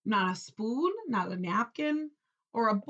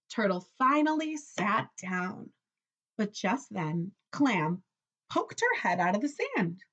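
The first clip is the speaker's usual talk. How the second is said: plain reading voice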